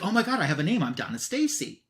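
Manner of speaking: high-pitched retold excitement, settling on the name; excited realization. Donna discovering her full name, excited realization. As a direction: act out a sudden joyful discovery.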